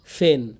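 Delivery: pen said wrongly as "fen"; P as full F. Shown as a demonstration. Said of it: "direct যদি ফ হয়".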